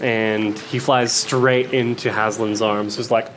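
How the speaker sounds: ordinary delivery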